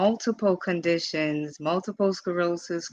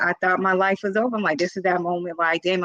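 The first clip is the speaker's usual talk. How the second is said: usual speaking voice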